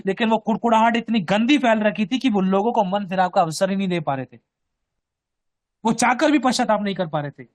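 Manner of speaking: ordinary talk, nothing marked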